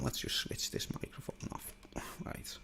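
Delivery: whispered aside; breathy whisper under the breath. Real, not acted. Aside to self.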